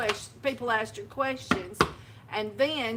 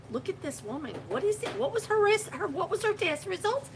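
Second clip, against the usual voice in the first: a hint of nosy, much higher voices; imitating curious staff; 9 semitones higher than usual. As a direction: mimic gawking hospital people. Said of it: "People would come in just to look".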